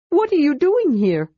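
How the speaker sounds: high, curious kender voice. A narrator's reading. Kender asks the dragon, high and curious. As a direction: ask brightly like a curious kender. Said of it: "Tas asked curiously".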